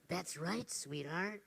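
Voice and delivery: soft, low and tender. Retold or imitated. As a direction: affirm her gently. Speaker softly affirms the child.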